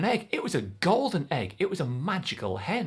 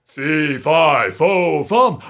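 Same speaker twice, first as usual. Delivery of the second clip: loud, booming fee fi fo fum; furious giant roar; 13 dB louder, much slower than usual. Acted. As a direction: bellow it as the woken giant. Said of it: "and the giant said".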